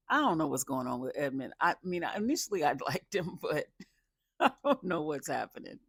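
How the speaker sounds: ordinary delivery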